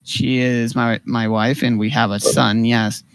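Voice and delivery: low, soft confession; quiet admission. Acted. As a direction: admit it reluctantly under your voice. [name] acted admitting his marriage.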